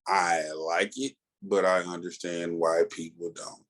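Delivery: ordinary delivery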